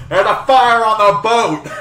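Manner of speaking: screaming alarm. Acted. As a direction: scream a hammed-up fire warning. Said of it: "he's just screaming".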